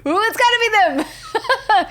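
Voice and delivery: high, drawn-out mock squeal of excitement; playful high excitement, breaking into laughter; retold excited guess. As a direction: re-enact a gleeful hunch, high and stretched.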